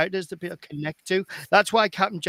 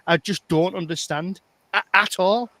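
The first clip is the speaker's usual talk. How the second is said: ordinary talk, nothing marked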